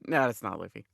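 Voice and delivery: quiet, low dismissal; deadpan. Retold or imitated. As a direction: say it low and dry.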